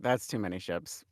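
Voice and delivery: low, quiet disbelief; deadpan mutter. Retold or imitated. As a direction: mutter it flatly under your breath.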